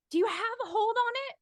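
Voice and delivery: a quick anxious question shouted up; girl calling up. Acted. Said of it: "And she says".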